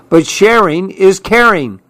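voiced child character's outcry; crying out. A narrator's reading. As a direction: cry it out as an eager objection. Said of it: "cried Clark".

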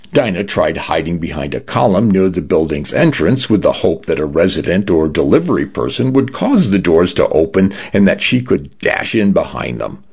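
usual speaking voice

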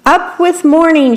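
high, bold chant; rallying shout. A narrator's reading. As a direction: shout it like a marching slogan. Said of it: "shouted the beetles".

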